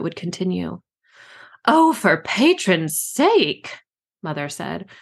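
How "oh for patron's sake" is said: exasperated. A narrator's reading. Queen exasperated with advisor.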